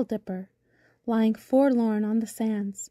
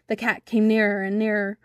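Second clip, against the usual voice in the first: plain reading voice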